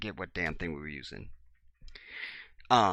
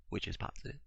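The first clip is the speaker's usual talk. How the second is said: fading aside; 2 semitones lower, 7 dB quieter, faster than usual. Shown as a demonstration.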